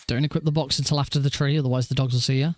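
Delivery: ordinary talk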